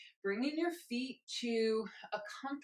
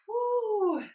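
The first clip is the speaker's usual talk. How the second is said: loud high whoop; excited whoop; 12 semitones higher, 9 dB louder than usual. Real, not acted. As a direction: whoop to celebrate. Cheer ending the countdown.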